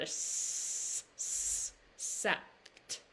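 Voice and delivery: s, s, sept whispered, hissing the s; whispered sounds. Shown as a demonstration. Teacher whispering the sounds of sept. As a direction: hiss the s then sept. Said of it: "Now the T's go together".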